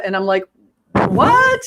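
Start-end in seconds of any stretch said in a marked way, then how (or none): 1.1-1.6 very high-pitched, stunned what; incredulous. Retold or imitated. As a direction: squeak out a retold disbelieving reaction. Retold baffled reply to accusation.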